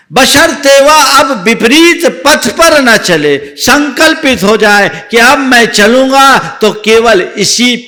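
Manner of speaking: high, loud preacher's vow; preaching at full force. Acted. Preacher urging the congregation, in a loud high proclaiming voice.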